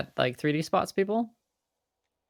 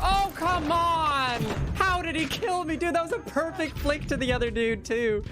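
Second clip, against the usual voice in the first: high, outraged complaint; raging gamer; 10 semitones higher than usual. Real, not acted. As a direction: rant in disbelief at the screen. Gamer killed unfairly, rage reaction.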